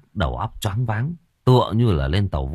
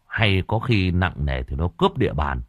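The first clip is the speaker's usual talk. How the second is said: ordinary delivery, nothing marked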